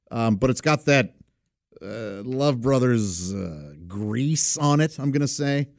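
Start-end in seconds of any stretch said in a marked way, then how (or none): none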